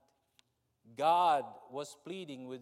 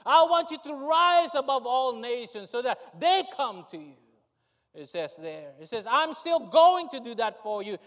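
loud, high preacher's cry; impassioned sermon delivery; preaching at full force; 9 semitones higher, 11 dB louder than usual. Acted. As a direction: preach it out with fire.

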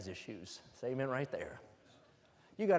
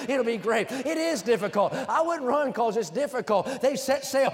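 louder, higher, driving sermon voice; full-force preaching; 6 semitones higher, 7 dB louder than usual. Acted. Preacher building to full force before the church. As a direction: preach it hard to the congregation.

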